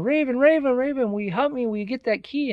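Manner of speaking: high-pitched call to a bird. Acted. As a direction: call up to the raven, pleading for help. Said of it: "the boy called on him".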